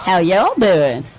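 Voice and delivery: slow, playful Texas drawl; Texas accent. Shown as a demonstration. Commentator teased about the accent returning, slow Texas accent. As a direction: lay on the Texas accent, drawn out. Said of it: "Texas accent came back".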